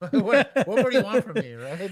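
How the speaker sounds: high, loud laughing disbelief; laughing exasperation. Pretend. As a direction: throw up your hands laughing. Mock exasperated suspect, laughing.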